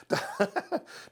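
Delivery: quick breathy chuckle; a breath of a laugh; little unvoiced chuckle; short airy laugh. Real, not acted. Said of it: "I can't get through that one without laughing".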